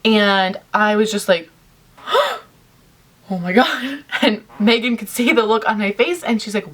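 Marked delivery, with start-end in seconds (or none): 1.8-4.0 mock shocked gasp into a high "oh"; stunned gasp. Friend reenacting first spotting the celebrity, stunned gasp. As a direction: act out breathless disbelief, pitched up.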